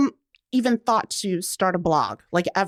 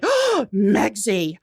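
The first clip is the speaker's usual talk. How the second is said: slow delighted realization; much slower than usual. Retold or imitated.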